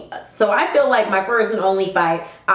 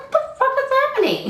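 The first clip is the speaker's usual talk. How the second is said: high, rushed mock panic; drunken bewilderment; much faster than usual. Retelling being drunk mid-fight, high bewildered squeal. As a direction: squeal it fast, acting out drunk confusion. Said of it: "I'm still drunk as fuck".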